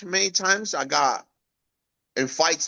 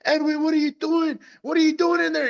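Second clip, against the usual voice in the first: much higher, alarmed shout of surprise; startled boss; 12 semitones higher, faster than usual. Retold or imitated. Boss finding the teen operating a loader, startled. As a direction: run out amazed at the kid on the loader.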